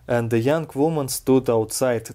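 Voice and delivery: usual speaking voice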